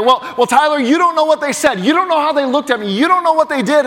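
high-pitched, insistent objection; protesting. Acted. Churchgoer objecting to the pastor about forgiving, insistent protest. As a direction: protest with rising pitch on each complaint. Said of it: "I know the tension point".

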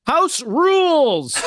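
high, drawn-out house-rules proclamation; mock strict announcement. In-laws lay down rules for newlyweds, mock strict. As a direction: imitate in-laws laying down the law, stretching it out. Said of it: "they're like".

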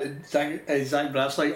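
ordinary delivery

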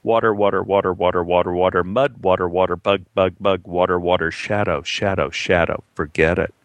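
low, monotone crawfish drone; low, rhythmic recital of a repetitive story; mock excerpt reading. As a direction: chant it evenly and low, deadpan. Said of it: "it might read something like this".

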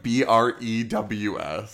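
spelling "B R E W S" slowly, letter by letter; épeler « B R E W S » lettre par lettre, lentement; spelled out letter by letter. Shown as a demonstration. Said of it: "Brews, like".

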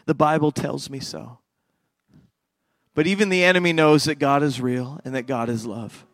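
soft, low altar call; prayer invitation. Acted. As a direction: quietly draw them into prayer.

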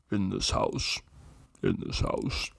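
low creepy voice dropping to a whisper; haunt the listener like a horror trailer; ghostly low whisper; eerie trailer voice. Retold or imitated. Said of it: "And it says it like".

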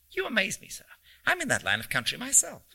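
formal amazement with a hint of squeak; squeaky voice. A narrator's reading. Stranger claiming the same trade to a passenger, lightly squeaky. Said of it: "he said in a squeaky voice".

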